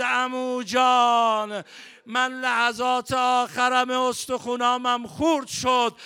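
loud, slow anguished cry for his uncle; dying cry. Retold or imitated. Dying Qasim calling to his uncle, a loud anguished wail. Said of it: "صدای قاسم این بود".

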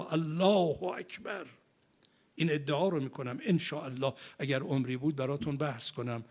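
ordinary talk, nothing marked

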